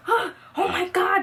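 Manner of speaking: shocked cry. Retold or imitated. Soda sprayed over her hair.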